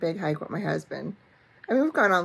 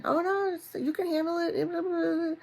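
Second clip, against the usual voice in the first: high, airy imitation of husband brushing off worries; lightly voiced husband saying it's fine; mock reassuring husband; 11 semitones higher than usual.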